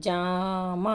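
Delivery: "జామ" with the first vowel stretched; long vowel. Shown as a demonstration. Teacher showing a long vowel, drawn-out "జామ". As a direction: draw out the "జా" of "జామ". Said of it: "చూడండి".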